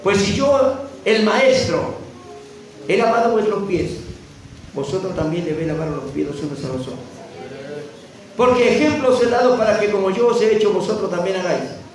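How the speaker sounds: ringing pulpit reading, high and forceful; full-force preaching. Real, not acted. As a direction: proclaim the verses to the whole congregation. Reads scripture at full force.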